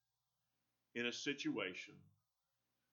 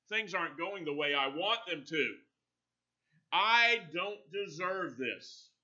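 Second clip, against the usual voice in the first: worldly mourner bemoaning his lot; self-pity; 6 semitones higher, 6 dB louder than usual. Retold or imitated. Self-pitying complaint quoted.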